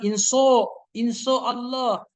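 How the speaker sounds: "inso Allah" with an "o" in place of "sya", drawn out slowly; mock the mistake, "inso Allah" said slowly; slow deliberate "inso Allah" mispronunciation; wrong pronunciation. Shown as a demonstration. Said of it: "Jangan begitu".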